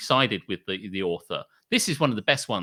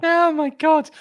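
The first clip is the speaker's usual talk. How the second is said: high squeal of disbelief; much higher, squealing oh my God; surprised gasp; 4 dB louder, slower than usual. Real, not acted. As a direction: shriek it in amused shock. Reacting to Reddit verdict.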